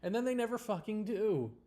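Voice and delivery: ordinary talk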